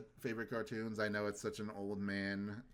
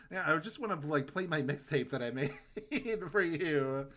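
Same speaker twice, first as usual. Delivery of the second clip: mock passive-aggressive whine, slowing at the end; sulky sidekick pushing his mixtape; 5 semitones higher than usual. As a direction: imitate a sulky friend being passive-aggressive. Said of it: "passive-aggressive the entire time".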